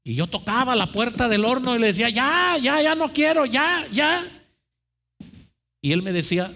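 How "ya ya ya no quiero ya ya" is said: high, strained begging cry; desperate pleading. Acted. The cup begging the potter to stop, desperate high plea. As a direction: beg to be let out, voice pushed high. Cup begs from inside the oven.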